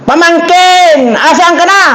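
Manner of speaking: high, slow shouted call. Acted. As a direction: shout for the lost nephew. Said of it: "sigaw siya ng sigaw".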